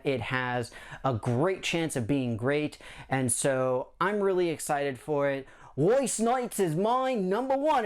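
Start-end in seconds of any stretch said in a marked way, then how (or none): 5.8-7.8 higher, slower put-on Australian accent; "my number one" stretched out in a hammy Australian accent; terrible Australian accent. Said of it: "sorry again for the terrible Australian accent".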